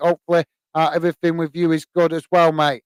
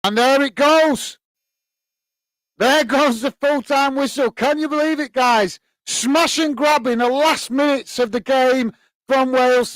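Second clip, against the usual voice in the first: high, excited full-time call; real thrill at the final whistle; passionate commentary; 6 semitones higher than usual. Real, not acted. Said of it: "Got quite, uh, quite passionate there".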